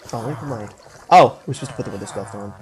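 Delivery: ordinary talk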